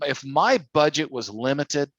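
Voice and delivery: ordinary talk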